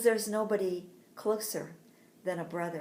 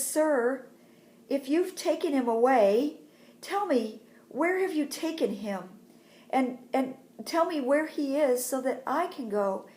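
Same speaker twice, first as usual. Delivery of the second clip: higher, louder acted plea; urgent pleading; 5 semitones higher, 5 dB louder than usual. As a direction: beg a stranger for help, voice lifted. Crying Mary begs the gardener.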